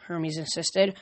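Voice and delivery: usual speaking voice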